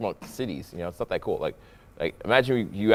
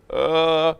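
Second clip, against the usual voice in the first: higher, drawn-out reluctant "uh"; hesitant; 7 semitones higher than usual. Retold or imitated. Girl unsure about answering his call, hesitant. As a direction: imitate a girl stalling on the phone. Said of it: "hesitant, or she doesn't really wanna".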